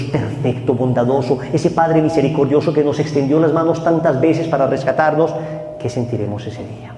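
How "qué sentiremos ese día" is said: hushed solemn warning. Real, not acted. Preacher warning the faithful softly of judgment. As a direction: drop to a grave hush.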